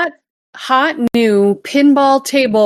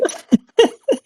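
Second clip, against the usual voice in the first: high, contagious giggle; laughing; 10 semitones higher than usual. Real, not acted. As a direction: catch the other person's laughter. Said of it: "now I'm laughing".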